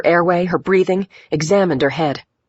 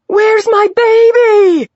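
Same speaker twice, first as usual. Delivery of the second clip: high, loud, drawn-out scream; 8 dB louder, slower than usual. A narrator's reading. Injured mother demanding her baby, screamed. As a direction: scream it out slowly at the top of your range. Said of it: "she screamed".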